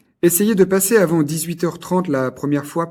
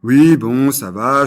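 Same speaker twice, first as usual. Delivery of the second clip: loud, lower, fed-up; louder exasperated dismissal; irritated brush-off; 3 semitones lower, 6 dB louder, slower than usual. Acted. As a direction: shove the complaint away. Owner irritated by scolding.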